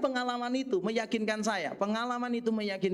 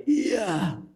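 retold shout; 6 dB louder than usual. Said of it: "sambil dia berteriak".

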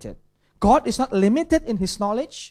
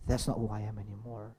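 hushed self-talk; 10 semitones lower, 13 dB quieter, faster than usual. Shown as a demonstration. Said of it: "is simply by saying".

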